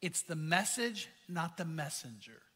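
tender rebuke, spoken low and quietly; the Lord's gentle correction. Retold or imitated. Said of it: "The Lord said".

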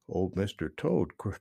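plain reading voice

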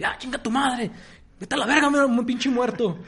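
high, loud mock cursing; cursing at the ghost. Sleeper cursing out the ghost sitting on him, loud and high. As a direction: yell insults at the ghost, half playing. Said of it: "dice groserías así".

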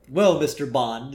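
usual speaking voice

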